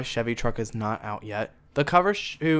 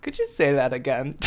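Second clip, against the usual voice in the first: cheeky request. Retold or imitated. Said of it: "really deranged".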